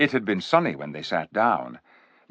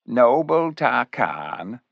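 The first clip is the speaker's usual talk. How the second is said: slow, silky and sly; drawn-out silky flattery; silky cat voice; 5 semitones higher, 3 dB louder, slower than usual. Acted. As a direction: purr the words like a scheming cat. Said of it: "in that silky voice of his".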